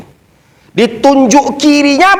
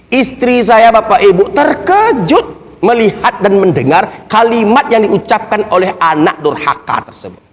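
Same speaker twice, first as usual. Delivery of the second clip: ordinary talk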